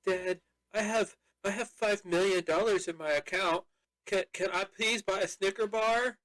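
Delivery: high-pitched, pleading put-on voice; daughter begging dad. Daughter asks dad for a Snicker bar, high mock pleading. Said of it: "that's how she talks".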